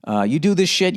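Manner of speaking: ordinary talk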